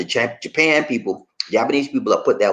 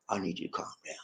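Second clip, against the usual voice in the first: much softer breathy soothing whisper; calming whisper; 3 semitones lower, 19 dB quieter, faster than usual. Retold or imitated. Man soothing angry girlfriend, soft whisper. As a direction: whisper it to settle her down.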